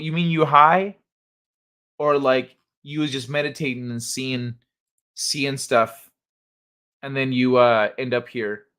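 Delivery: normal talking voice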